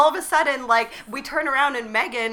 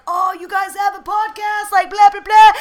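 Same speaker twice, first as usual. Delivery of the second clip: high, hammed-up interest getting louder; mock enthusiasm; 7 semitones higher, 7 dB louder than usual. Actor hears of their podcast, hammed-up enthusiasm. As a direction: imitate keen surprise, ending in loud blahs.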